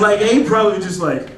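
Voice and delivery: ordinary talk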